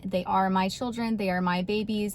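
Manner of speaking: normal talking voice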